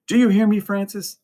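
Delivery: high-pitched scolding nun; high, strict voice of a nun in charge; stern Sister Rita. A narrator's reading. Nun catching two children chatting between rooms, stern and high. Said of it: "and then Sister Rita's voice".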